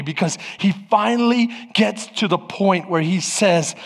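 ordinary talk, nothing marked

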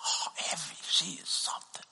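whispered fond praise; hushed aside. Retold or imitated. Mentor whispering praise of Evie. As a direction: lean in and whisper it fondly.

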